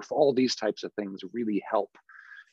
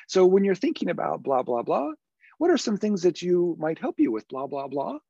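higher, bobbing pitch with rises; head-bobbing inviting question; approachable voice; 7 semitones higher than usual. Shown as a demonstration. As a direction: lift and dip the pitch as if nodding. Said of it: "My head is bobbing".

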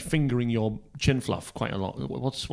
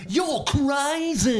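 high, slow manic cartoon voice; Crazy [name] voice; 11 semitones higher, much slower than usual. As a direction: play a gleeful loony prankster. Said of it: "Hello, it's me, Crazy [name]".

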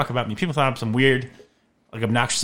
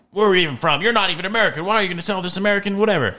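high-pitched mock outrage; put-on suspicious complaining; imitated indignant customers; 8 semitones higher, faster than usual. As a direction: challenge the caller, pitched well up. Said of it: "but people are like".

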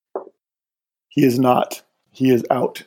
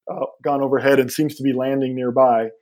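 normal talking voice

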